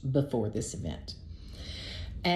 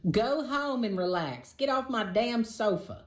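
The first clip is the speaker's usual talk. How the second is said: higher, slow scream held at talking volume; a hammed-up scream inside the head; mock inner scream; 6 semitones higher than usual. Host's inner voice at the date lounging on her sofa, mock screaming. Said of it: "the voice was screaming".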